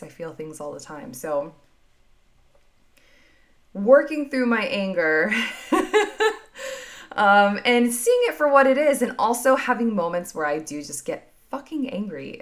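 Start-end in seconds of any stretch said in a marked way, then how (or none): 5.4-7.1 short laugh. Real, not acted. Laughs about her anger.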